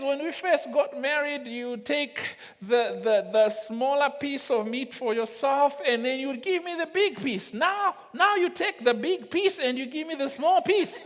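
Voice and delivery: complaining wife. Retold or imitated. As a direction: play up her hurt, half-joking. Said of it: "He says".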